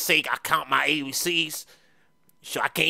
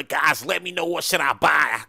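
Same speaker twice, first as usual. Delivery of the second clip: ordinary talk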